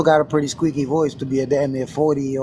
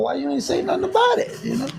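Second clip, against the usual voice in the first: high, laughing mock complaint; laughing retort. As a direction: squeal it through a laugh. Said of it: "I'm like".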